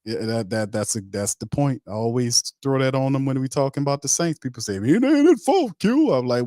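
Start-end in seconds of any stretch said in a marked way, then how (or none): 4.7-6.1 high-pitched, loud mimicry; mocking critics. Host mimicking what people say about the Saints, high and mocking. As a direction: mimic the naysayers in a raised, high voice.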